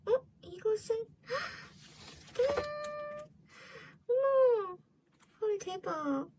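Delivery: high squealing gasp of delight. Real, not acted. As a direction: squeal with sudden excitement. Unboxing, surprise at rare card.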